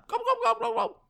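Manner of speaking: high-pitched gobbling run; turkey gobble. Shown as a demonstration. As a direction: demonstrate a very high turkey call. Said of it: "make this sound".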